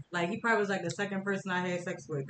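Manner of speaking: ordinary delivery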